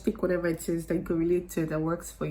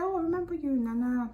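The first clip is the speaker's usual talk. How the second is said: high voice; 9 semitones higher than usual. Retold or imitated. Quoting the man on phone.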